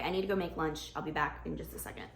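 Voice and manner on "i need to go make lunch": ordinary talk, nothing marked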